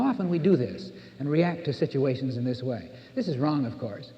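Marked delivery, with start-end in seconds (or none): none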